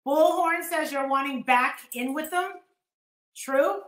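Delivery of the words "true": usual speaking voice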